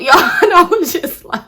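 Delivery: high, loud laugh through the words. Real, not acted. Laughing while telling viewers about the cussing. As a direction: burst out laughing.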